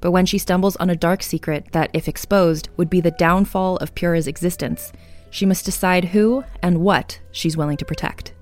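ordinary delivery

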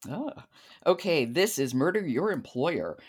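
ordinary talk, nothing marked

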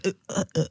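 soft, halting repeated syllables; imitated stutter. Shown as a demonstration. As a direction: get stuck on a short sound, quietly. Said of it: "because I had a stutter".

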